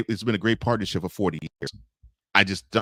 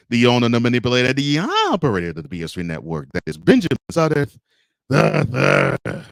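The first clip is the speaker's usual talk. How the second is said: louder, higher and fast, like a ring announcer; hype announcer intro; 5 semitones higher, 6 dB louder than usual. Real, not acted. Host hyping the network owner to his audience, announcer style. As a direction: build the big name up to the third.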